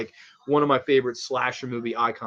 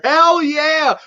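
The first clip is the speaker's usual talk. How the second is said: loud, high Hell yeah; excited shout; 13 semitones higher, 9 dB louder than usual. Real, not acted. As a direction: whoop it out. Excited reaction to comment.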